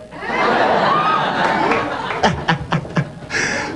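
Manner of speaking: a long squeaky breathless cackle; breathy high-pitched giggle fit; high wheezing laugh. Real, not acted. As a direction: laugh high and airy at your own joke. Laughs at own joke.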